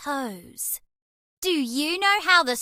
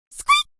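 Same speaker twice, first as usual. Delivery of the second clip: high loud squeak of delight; mouse squeak; 5 dB louder than usual. Acted. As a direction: let out a happy mouse squeak.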